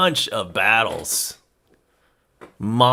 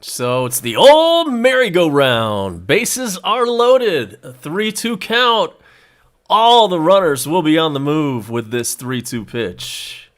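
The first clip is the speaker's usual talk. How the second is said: play-by-play; 2 semitones higher than usual. Acted. Live baseball play-by-play call.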